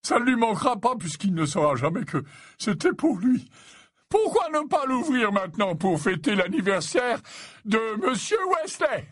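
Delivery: high-pitched, merry host; jovial old professor. Acted. Slughorn opening the mead for Ron's birthday, jovial. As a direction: propose a treat in professor voice.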